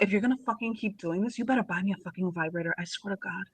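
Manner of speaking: soft, snide muttered demand; bratty mutter. Acted. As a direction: mutter it like a spoiled brat. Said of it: "just to be a super bitch about it".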